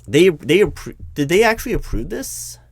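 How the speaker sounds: suddenly high, loud, can't believe it; alarmed disbelief. Acted. Interviewer realizes the boss skipped approval, high and alarmed.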